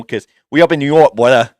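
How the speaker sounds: put-on loud New York accent slipping into Boston; New York accent, dropped r on "brother"; attempted New York accent. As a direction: lean hard on a botched New York accent. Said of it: "I can't do a New York accent".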